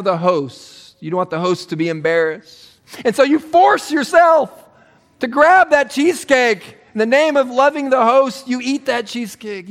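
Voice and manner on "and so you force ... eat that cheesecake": hammed-up noble excuse; mock heroic. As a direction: sell the sacrifice with fake nobility.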